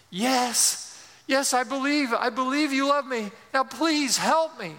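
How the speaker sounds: high, insistent pleading; acted panic. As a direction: beg for help as a panicky man hanging on. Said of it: "still panicky".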